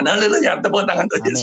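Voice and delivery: high, loud jubilant call; exultant preacher's cheer; rallying praise shout. Real, not acted. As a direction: call the congregation to applause. Rallies congregation to applaud.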